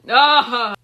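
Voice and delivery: short, loud acted yell; sudden yell. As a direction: cry out loudly, all at once. Character cries out suddenly.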